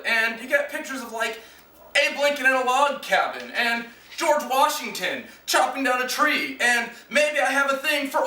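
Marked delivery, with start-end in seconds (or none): none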